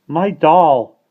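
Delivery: put-on high, loud toddler exclamation; proud little-child cry. A narrator's reading. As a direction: claim it like a small child. Toddler claims the baby.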